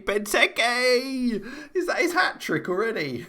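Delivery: high, excited cry as the goal goes in; excited goal call. Real, not acted. Commentator as the striker completes his hat-trick, excited. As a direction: celebrate the goal, pitch jumping right up.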